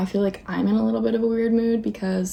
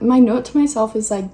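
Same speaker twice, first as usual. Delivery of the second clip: normal talking voice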